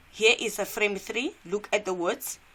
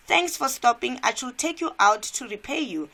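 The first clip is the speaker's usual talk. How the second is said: acted thanks; 5 semitones higher, 5 dB louder than usual. Voicing Matt thanking the jogger.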